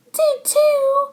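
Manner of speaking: very high, loud did too; squealing retort. Acted. Chloe shrieks back at Max. Kids bicker.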